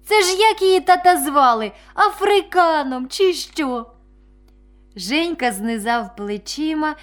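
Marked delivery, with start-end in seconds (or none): 0.0-3.9 put-on higher, louder boyish question; astonished boy. A narrator's reading. Vasyl asks Zhenka about the dentist's father's name, astonished. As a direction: blurt it out to a friend, drawing out the last words. Василь здивовано питає Женьку.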